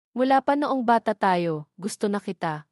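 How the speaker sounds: ordinary delivery, nothing marked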